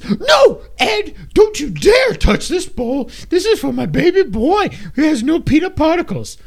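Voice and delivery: high, slow, crying mom voice; man doing a woman's voice. Retold or imitated. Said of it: "no one knows that I'm making fun".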